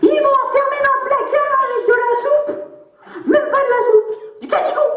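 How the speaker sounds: plainte geignarde. Retold or imitated.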